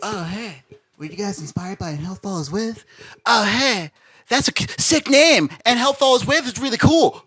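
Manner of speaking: a hammed-up fan gushing over the name; mock fan comment. Band member mocking fans who confuse names, high and gushing.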